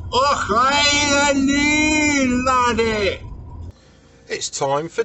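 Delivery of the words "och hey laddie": loud, high hammed-up Scottish hail; mock Scottish accent. Vlogger arriving in Glasgow, loud mock Scottish greeting. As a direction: shout it like a stage Scotsman.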